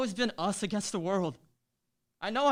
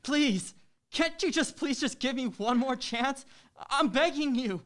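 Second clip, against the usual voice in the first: raised, higher pleading voice; desperate begging; 4 semitones higher, 3 dB louder than usual. Acted. Boyfriend begs his ex for one more chance, loud and pleading. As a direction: beg her loudly, voice up high.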